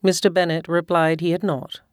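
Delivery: plain reading voice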